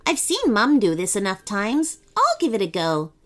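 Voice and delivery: put-on high, brave little boy; plucky small child; gingerbread boy voice.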